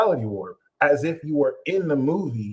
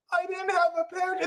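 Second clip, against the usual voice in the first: meek partner reply; 5 dB quieter, faster than usual. Retold or imitated. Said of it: "partner answers meekly".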